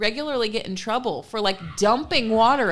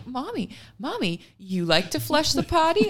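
put-on chatty toddler; 7 semitones higher than usual. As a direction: imitate a small child making small talk. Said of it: "She's chatting".

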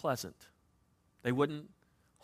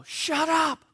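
yelling at the barking dogs, much higher; retold yell; 13 semitones higher, 7 dB louder than usual. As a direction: snap a sharp shout at the dogs. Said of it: "And I yell".

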